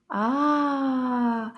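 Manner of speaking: ordinary delivery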